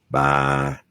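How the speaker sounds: ordinary delivery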